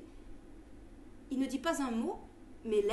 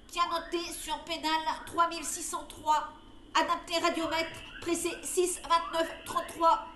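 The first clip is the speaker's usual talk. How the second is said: bizarre machine voice; 5 semitones higher, 4 dB louder than usual. Acted. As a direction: speak like a creaking talking machine. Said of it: "avec sa voix bizarre où se mélangent les grincements".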